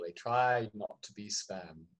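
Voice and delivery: mock her words as if they were pious; mocking echo. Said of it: "Oh, how very noble".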